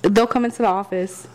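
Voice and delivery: normal talking voice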